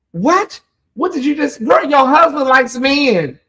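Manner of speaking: high, exasperated disbelief; incredulous. Acted. Acted disbelief at an oblivious wife.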